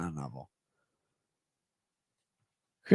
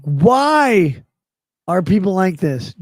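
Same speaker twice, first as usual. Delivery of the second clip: exasperated; 4 semitones higher, 8 dB louder, slower than usual. Retold or imitated. Exasperated retold complaint.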